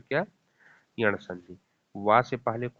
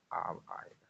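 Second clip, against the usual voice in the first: trailing whisper; 4 semitones lower, 12 dB quieter than usual. Real, not acted. Listing sound patterns.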